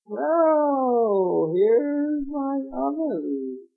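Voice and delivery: high, slow, sly witch voice. Acted. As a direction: drawl it out like a scheming old witch. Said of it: "the witch came down and said".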